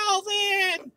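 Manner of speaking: very high-pitched cartoon chipmunk cry; squirrel voice. Teasing listeners about speeding up his voice, squirrel voice. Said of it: "on squirrel voice".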